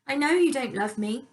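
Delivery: a touch of a whiny, petulant stepmother; lightly whining, sulky plea. A narrator's reading. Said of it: "she cried in a whiny, petulant voice".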